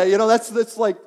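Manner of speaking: ordinary delivery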